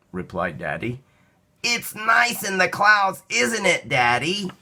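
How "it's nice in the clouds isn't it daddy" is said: put-on child voice. Child enjoys the clouds.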